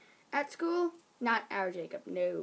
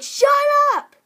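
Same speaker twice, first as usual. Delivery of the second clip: mock rude shout; 14 dB louder than usual. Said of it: "And he's like".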